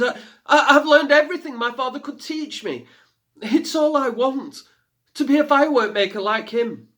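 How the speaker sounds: loud, high, earnest stammering plea; pleading girl. A narrator's reading. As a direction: beg him to believe her.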